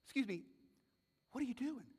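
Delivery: soft, cautious aside; tentative questioning. Acted. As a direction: quietly check on a strange neighbor. Passenger cautiously questions a stranger.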